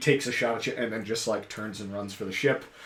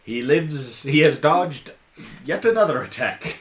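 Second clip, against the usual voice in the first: louder, laughing as it unfolds; excited narration; 4 semitones higher, 9 dB louder than usual. A narrator's reading. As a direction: announce the dodge with glee. GM narrates enemy dodging again.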